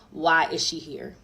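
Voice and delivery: normal talking voice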